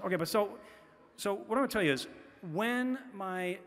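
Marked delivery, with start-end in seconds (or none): none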